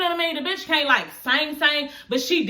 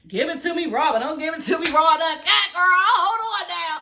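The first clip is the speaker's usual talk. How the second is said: raised, high-pitched sassy objection; mock protest; 6 semitones higher, 3 dB louder than usual. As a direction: act out being taken aback, pitch jumping up. Acted startled protest at blunt criticism.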